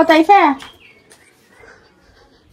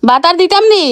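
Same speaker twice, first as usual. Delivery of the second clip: louder and higher repeat; to someone hard of hearing; 7 semitones higher, 5 dB louder than usual. Real, not acted. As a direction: shout the question again, higher. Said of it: "এই জন্য চিল্লাই চিল্লাই কথা বলা লাগে".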